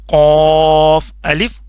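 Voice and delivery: قاف said thick and heavy from the throat, slow; heavy qaaf. Shown as a demonstration. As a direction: give قاف a full heavy sound, drawn out. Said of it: "موٹا کر کے پڑھنا ہے".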